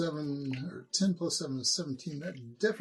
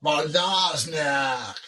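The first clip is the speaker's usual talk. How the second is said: loud, drawn-out demon cry; slow, forceful monster battle cry; demon's dying scream; 2 semitones higher, 8 dB louder, much slower than usual. Acted. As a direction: scream it skyward as a doomed demon. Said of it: "looks at skyward and screams".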